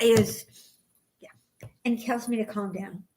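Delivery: ordinary talk, nothing marked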